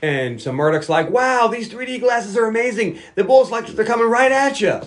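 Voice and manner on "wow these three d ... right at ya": higher, giddy put-on voice; mock Murdoch. Murdoch marveling at bullets through 3D glasses. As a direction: marvel like a loony pilot.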